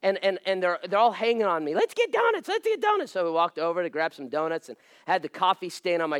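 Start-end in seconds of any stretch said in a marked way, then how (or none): 1.7-3.1 high-pitched mimicked kids; begging children. Pastor's kids hanging on him at church, imitated high begging.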